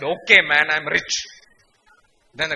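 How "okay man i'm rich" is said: loud mock surrender. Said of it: "I said".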